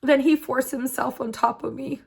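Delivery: fighting back real tears to get each phrase out; tearful. Real, not acted. Survivor recounting her partner's assault, tearful.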